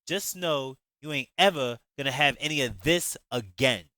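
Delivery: loud, scornful angry retort; with an attitude. Acted. Shantae telling Jay off before leaving, loud angry attitude. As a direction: hurl insults as she leaves. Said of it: "Shantae said with an attitude".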